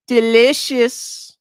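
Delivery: high, slowly drawn-out word; exaggerated relish. Streamer gloating over his account balance, with drawn-out relish. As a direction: savor it slowly in a high put-on voice.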